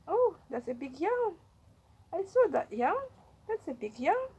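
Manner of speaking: thrilled at the bunny's yawn; delighted pet talk about a yawn; delighted coo. Real, not acted. Reacting to rabbit's yawn.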